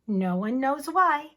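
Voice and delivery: plain reading voice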